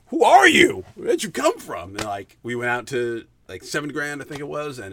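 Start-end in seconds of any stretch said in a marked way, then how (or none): 0.1-1.9 loud, high thrilled outburst; excited disbelief. Retold or imitated. Retelling his date, shouting in thrilled disbelief. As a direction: shout it like you can't believe your luck.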